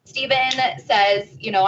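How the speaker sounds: normal talking voice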